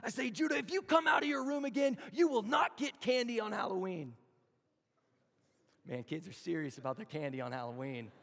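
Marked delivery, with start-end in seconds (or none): 0.3-4.2 high, loud, stern threat; loud warning. Retold or imitated. Father threatening his son over Halloween candy, loud and stern. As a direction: lay down the law to a kid. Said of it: "I'm speaking loud".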